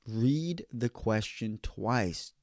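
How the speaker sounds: very slow, weighted words; emphatic advice. Real, not acted. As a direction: drag out "read the question twice", pausing between words. Driving home the key advice.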